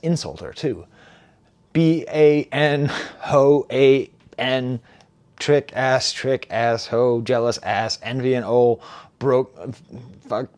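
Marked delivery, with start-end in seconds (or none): 1.7-10.5 halting insults in short bursts; slow, choppy mock-disgusted list; too disappointed to speak. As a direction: imitate a rapper spitting out broken fragments. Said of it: "he could barely even speak".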